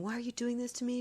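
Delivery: mild mock scream from a frantic woman; echoing the neighbour's panicked cry; retold distraught scream. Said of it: "She's screaming".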